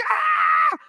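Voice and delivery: put-on a fast, loud shriek of excited kids; retold kids' shriek. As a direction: shriek like a rowdy class. Kids screaming in class.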